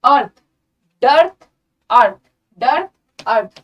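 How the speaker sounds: अर्थ and डर्थ punched loud with the R sounded; loud teacher drill of डर्थ, अर्थ; spelling pronunciation. Shown as a demonstration. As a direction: stress the R in डर्थ to link it with अर्थ. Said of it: "spelling में आर को ध्यान देंगे".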